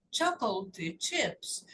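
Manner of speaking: chocolate chips slowly, stress on CHOC; first syllable up. Shown as a demonstration. Teacher models the phrase, chocolate chips stressed first. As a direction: punch CHOC in chocolate, drop the rest. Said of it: "se essa sílaba sobe, a sílaba de baixo desce".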